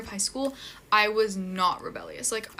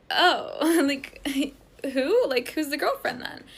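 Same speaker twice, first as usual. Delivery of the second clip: higher-pitched, taken-aback questioning; mock puzzled surprise; retold puzzlement; 5 semitones higher, slower than usual. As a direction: act out being caught off guard. Acts out puzzled reaction to news.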